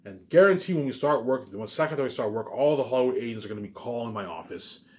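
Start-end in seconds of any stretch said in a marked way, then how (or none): none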